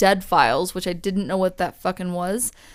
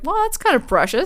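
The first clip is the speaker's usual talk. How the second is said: mock sweet; 8 semitones higher than usual. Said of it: "I was like".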